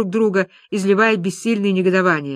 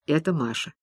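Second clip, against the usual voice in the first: usual speaking voice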